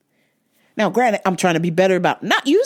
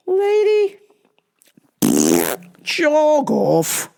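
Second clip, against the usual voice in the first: mock retort; 6 semitones higher, much slower than usual. Retold retort to customer.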